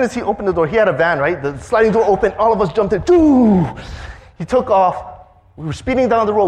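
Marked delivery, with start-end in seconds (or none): 3.0-3.7 high, loud whoosh of the van; zoom sound. Retold or imitated. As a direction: blast it like a car speeding off. Van taking off.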